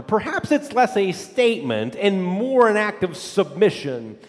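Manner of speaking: ordinary talk, nothing marked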